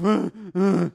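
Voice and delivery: loud hammed-up donkey bray. Imitating the donkey sound.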